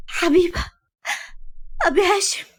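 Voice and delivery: high, broken words of grief; breaking down. Acted. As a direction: voice collapsing mid sentence. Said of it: "انهارت ملك وهي بتقول". (صوت منهار بالبكا)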